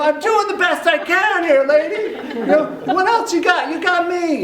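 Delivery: loud, high exasperated retort; high mock-angry yelling; yelling back. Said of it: "I'm start yelling back at her".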